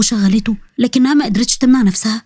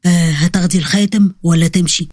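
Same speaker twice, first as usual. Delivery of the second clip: low-pitched mysterious man; 6 semitones lower than usual. A narrator's reading. Mysterious man presses courier to take the ring, low voice. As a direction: voice him low, well under your own.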